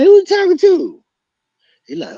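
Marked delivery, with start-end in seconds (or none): 0.0-1.0 high, loud retort; angry challenge. Retold or imitated. Teller to the rival's friend in suspension, loud high retort. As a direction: fire it back, ready to fight. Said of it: "I'm like".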